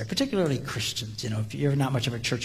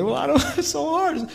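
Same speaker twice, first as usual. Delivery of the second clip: much higher, mock whining; whiny excuse; 10 semitones higher, 3 dB louder than usual. Churchgoers who struggle to forgive, high mock whine.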